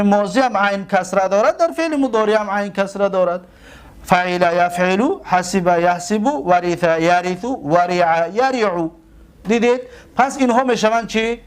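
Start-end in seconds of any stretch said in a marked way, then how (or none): 4.0-8.9 each pair said slowly and evenly, one by one; slow paradigm recital. Shown as a demonstration. Teacher reciting verb pairs slowly so students can follow.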